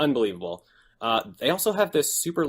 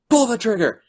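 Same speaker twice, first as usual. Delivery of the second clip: high, loud shouted command; retold yell; 11 semitones higher, 7 dB louder than usual. Jack yelling at Chloe to shoot him, loud shout. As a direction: bark it like an order at gunpoint. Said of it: "he's just yelling at her".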